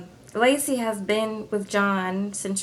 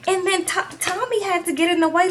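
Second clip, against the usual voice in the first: ordinary talk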